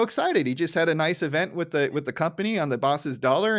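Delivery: usual speaking voice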